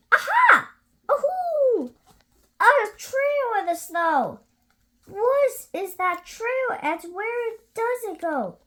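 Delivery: high, loud excited little voice; excited child monster. Acted. Gruffalo's child spotting a trail, high and thrilled. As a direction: discover the trail with glee.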